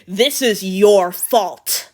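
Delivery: loud, slow snarl. Acted. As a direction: snarl it with hatred. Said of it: "Marcus snarled".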